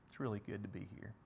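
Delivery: awed Peter barely getting the words out; underwhelmed mumble. Retold or imitated. Overwhelmed Peter on the mountain, murmuring softly to Jesus. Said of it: "That's kind of an underwhelming statement".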